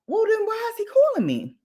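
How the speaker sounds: high-pitched retold bafflement; baffled. Storyteller who can't find the caller, baffled. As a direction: act out your confusion in a high voice. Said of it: "So I'm like".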